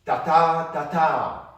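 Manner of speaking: teacher tapping a rhythm for students; loud rhythm demo. Shown as a demonstration. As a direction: hammer out the beat. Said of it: "Now do this one".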